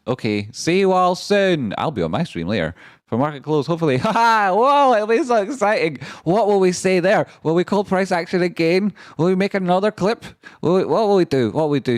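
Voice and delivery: high, loud hyped chatter; gleeful shout of promises; excited sign-off. Real, not acted. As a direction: pump up the chat for later.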